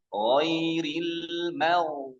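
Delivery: Ghairil mau very slow, gh held heavy; thick gh drawn out. Shown as a demonstration. Teacher modelling thick ghain after fathah. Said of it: "kan gitu kan".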